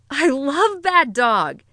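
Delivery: high, drawn-out gush of excitement; excited. A narrator's reading. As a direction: gush it, voice rising with delight. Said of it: "her voice rising in excitement".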